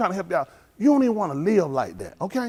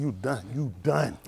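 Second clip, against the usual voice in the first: slow taunt; 7 semitones lower, 7 dB quieter, slower than usual. Retold or imitated. Bluffing threat to the cop.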